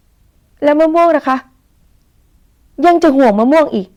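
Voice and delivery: stern snap. Acted. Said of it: "ดาเนียลตะคอกเสียงดุ".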